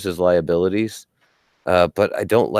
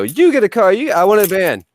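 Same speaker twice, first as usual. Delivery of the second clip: Oprah giveaway voice; 12 semitones higher, 6 dB louder than usual. Acted. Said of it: "we need to go on the Oprah show".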